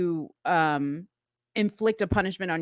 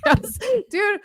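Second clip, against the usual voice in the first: ordinary delivery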